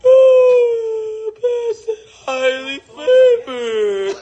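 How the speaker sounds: wailing fake sobs, very slow and drawn out; mock crying. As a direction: beg through hammed-up tears. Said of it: "stream now or start crying".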